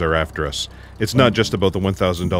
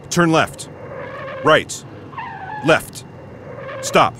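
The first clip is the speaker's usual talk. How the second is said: shouted order; 8 semitones higher, much slower than usual. Acted. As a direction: bark the turn at the driver. Shouting directions while fleeing.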